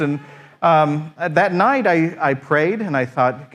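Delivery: ordinary talk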